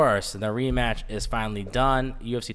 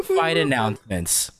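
ordinary talk, nothing marked

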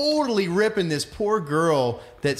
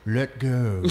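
Connery impression; 5 semitones lower than usual. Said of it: "Is that your Connery?".

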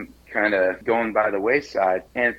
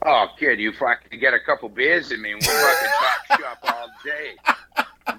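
put-on Boston accent; 2 semitones higher than usual. Asked how he escaped the Boston accent, plays up a Boston accent. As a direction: slip into a laughing Boston barfly accent. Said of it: "that Matt Damon, Ben Affleck, bad Boston accent".

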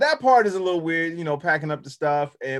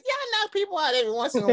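high, fast, laughing mock Tyson voice; Tyson impression; 12 semitones higher, much faster than usual. Mocking his cohost's humble brag, high fast Tyson voice. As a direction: squeak it out fast like a boxer bragging. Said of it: "that's like Tyson saying".

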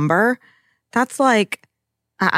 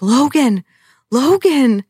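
called out higher and louder, name repeated; calling out; 7 semitones higher, 5 dB louder, much slower than usual. Retold or imitated. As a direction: call for Logan as if lost and desperate. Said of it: "He's just like".

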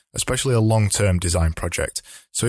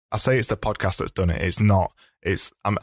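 normal talking voice

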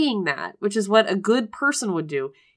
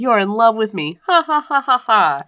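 taunting quote ending in a hammed-up ha ha ha; mocking laugh; 5 dB louder than usual. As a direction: gloat like a smug villain, laughing.

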